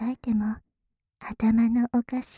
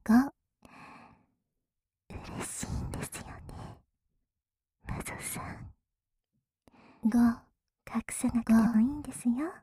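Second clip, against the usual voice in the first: whispering. Acted. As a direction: tease in a hushed whisper. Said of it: "ささやいてる反対のお耳から".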